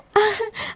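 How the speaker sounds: short high nervous giggle; nervous laugh. Acted. Bride covering her slip with a nervous giggle.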